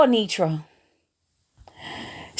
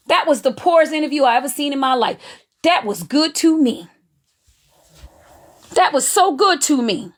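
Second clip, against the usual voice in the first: ordinary talk, nothing marked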